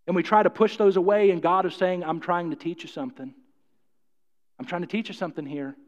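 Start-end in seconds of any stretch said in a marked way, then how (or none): 2.0-5.8 soft, low, patient divine voice; gentle voice of God. Pretend. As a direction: speak as God, tender and hushed.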